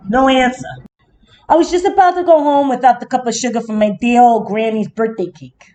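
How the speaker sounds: ordinary delivery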